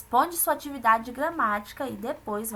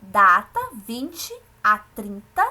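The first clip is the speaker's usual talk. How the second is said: measured classroom read-aloud, headings hit louder; reading aloud to pupils; 4 semitones higher, 5 dB louder, slower than usual. Acted. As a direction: read it out slowly, phrase by phrase. Reads the text aloud to pupils.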